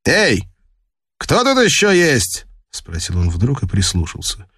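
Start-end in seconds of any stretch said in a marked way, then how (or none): 0.0-2.5 high-pitched, suspicious call; abrupt questioning with raised pitch; sudden challenge. Acted. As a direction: call out as if you just heard someone. Sailor suddenly challenges hidden person.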